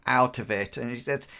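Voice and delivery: usual speaking voice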